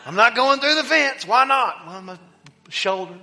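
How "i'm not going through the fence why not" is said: put-on high, loud childlike protest; a little boy arguing with himself; excited kid. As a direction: shout it like a kid at a football game. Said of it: "I'm like".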